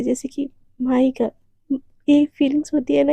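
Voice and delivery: plain reading voice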